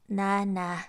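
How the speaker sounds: loud pleading refusal; giant's wife refusing the boy at the door, loud; cried out no. A narrator's reading. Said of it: "she cried".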